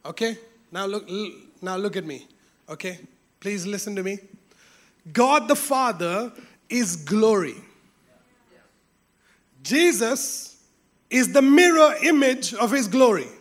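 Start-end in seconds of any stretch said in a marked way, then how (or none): none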